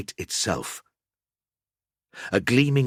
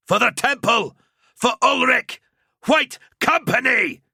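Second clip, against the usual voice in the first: high, loud rallying roar; bellowed battle cry; 5 dB louder than usual. A narrator's reading. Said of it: "he bellowed".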